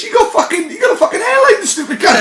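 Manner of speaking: pitched high, mock outrage breaking into laughter; laughing outraged rant. Real, not acted. Ranting to friends about Richard Branson's airline, high laughing outrage.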